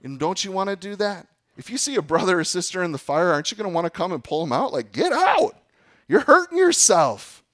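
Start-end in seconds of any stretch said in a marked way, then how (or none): 4.9-7.4 much higher and louder, an urgent warning cry; urgent shout. Retold or imitated. Preacher voicing a believer pulling a brother out of sin, urgent shout. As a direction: yell it like pulling someone from a fire.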